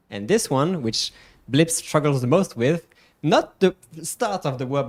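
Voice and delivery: ordinary delivery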